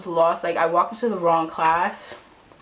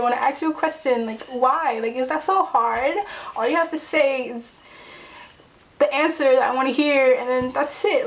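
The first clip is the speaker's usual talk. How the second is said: raised, exasperated venting; pitched-up, aggrieved retold tirade; frustrated rant; 6 semitones higher than usual. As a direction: plead indignantly with people who ignore you. Imagined rant at unfriendly classmates.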